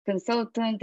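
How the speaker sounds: ordinary talk, nothing marked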